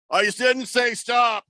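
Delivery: stoned P.E. teacher. Retold or imitated. Stoned gym teacher to kids who stopped running, drawn-out mock yell. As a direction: call it out lazily from a lawn chair. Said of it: "he was so stoned".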